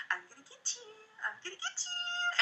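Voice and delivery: put-on tickle-threat to make a baby giggle; playful baby teasing. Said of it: "be like".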